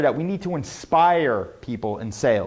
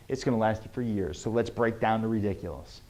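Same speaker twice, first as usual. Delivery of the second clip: normal talking voice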